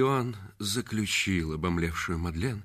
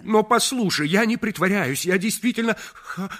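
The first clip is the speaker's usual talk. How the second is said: loud, high, stuttering cry; desperate stammering shout; 13 semitones higher, 9 dB louder than usual. Acted. Reggie desperately stammering he wants to marry her, crying loud. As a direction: choke on the words and shout through them.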